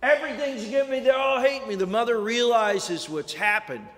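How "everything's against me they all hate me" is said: put-on higher, a touch of a frightened boy; scared son. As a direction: plead to mom, lightly frightened. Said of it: "He runs scared".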